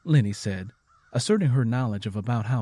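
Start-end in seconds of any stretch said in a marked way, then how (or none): none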